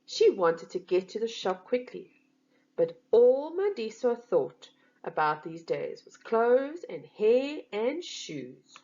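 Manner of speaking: ordinary delivery, nothing marked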